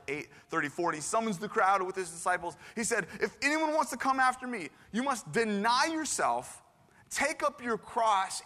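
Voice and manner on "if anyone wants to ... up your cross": slow, raised, declaimed call; high, measured preaching delivery; solemn proclamation. Acted. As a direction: address a crowd, weighting each phrase slowly. Declaims Jesus's call to the crowd.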